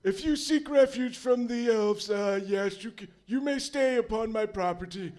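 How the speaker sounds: lightly voiced kindly old man; old wizard host. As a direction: offer shelter graciously. Crandon offers refuge.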